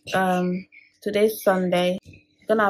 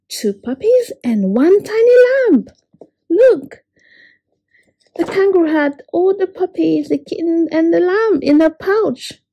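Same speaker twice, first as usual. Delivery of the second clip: much higher, louder delighted exclamations; excited reveal; 8 semitones higher, 5 dB louder than usual. Acted. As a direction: show the child the found babies with joy. Joyful reveal of found babies.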